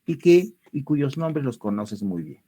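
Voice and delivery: usual speaking voice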